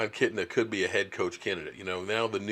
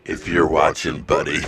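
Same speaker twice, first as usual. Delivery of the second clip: much lower, mock ominous; low teasing voice; 7 semitones lower, 5 dB louder than usual. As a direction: drop your voice low to tease. Repeats the tease, lower.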